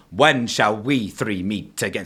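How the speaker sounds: "When shall we three meet again?" slow, each beat weighted; reverse iambic. Shown as a demonstration. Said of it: "they are in reverse iambic".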